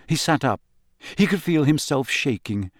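ordinary delivery, nothing marked

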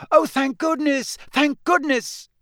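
high, loud cry of relief; relieved cry. A narrator's reading. Mother seeing her family return, crying out high. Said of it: "a cry echoed along the lane".

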